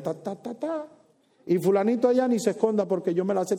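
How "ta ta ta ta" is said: mumbled gossip. Acted. Mocking gossip.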